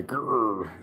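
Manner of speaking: ordinary delivery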